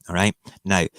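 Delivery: ordinary delivery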